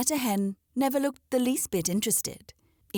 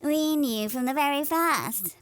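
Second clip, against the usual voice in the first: knowing hen; 6 semitones higher than usual. Acted. Polly hen speaking.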